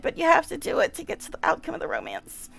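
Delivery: ordinary talk, nothing marked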